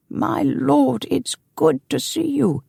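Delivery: put-on high-pitched old servant's greeting; old man. A narrator's reading. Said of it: "said an old man".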